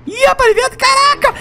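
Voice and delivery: high shrieking laugh; excited screech. Real, not acted. Gamer shrieking with laughter as enemy charges. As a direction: squeal as the monster closes in. Enemy comes after his shark.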